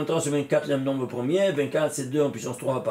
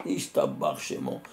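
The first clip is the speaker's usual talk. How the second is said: "Ishtabar Shemo" dropped far lower and slowed, solemnly; deep solemn intoning; 4 dB quieter than usual. Shown as a demonstration. Teacher revealing the highest name to students, deep and solemn. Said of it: "Le plus élevé".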